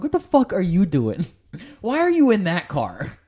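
high, loud, disbelieving shout through laughter; half-laughing mock outrage; incredulous yell. Incredulous yelling at other drivers.

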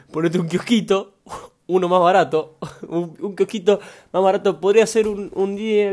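laughter breaking into the suggestion; amused laughing. Real, not acted. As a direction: read it out while cracking up. Laughing at a listener's cheaper idea.

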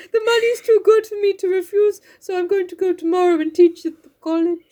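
high put-on voice with amusement behind it; Mister Bear. Bear explaining his departure, put-on voice. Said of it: "I'm so racist against bears".

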